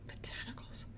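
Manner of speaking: whispered echo of the brand name; mocking whisper. Real, not acted. Reviewer repeating a silly product name to herself, mocking whisper. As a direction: whisper it under your breath, mockingly.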